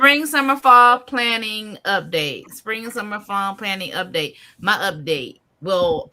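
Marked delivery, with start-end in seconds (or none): 0.0-2.5 loud, raised title call-out, slowing at the end; high, loud heading with a stretched finish; segment announcement. Real, not acted. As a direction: announce it big, high and drawn out.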